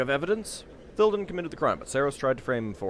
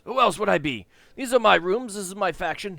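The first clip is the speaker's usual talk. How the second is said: high, loud indignant snap; snapping; 8 semitones higher, 7 dB louder than usual. Acted. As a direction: snap back in outrage. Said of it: "The voice snaps".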